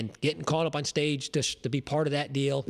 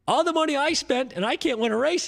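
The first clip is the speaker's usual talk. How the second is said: high put-on accent, complaining; imitating Felix's accent; 10 semitones higher than usual. Retelling Felix's complaint to the crew, high mock accent. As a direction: pitch it way up in his accent. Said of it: "he's got that accent".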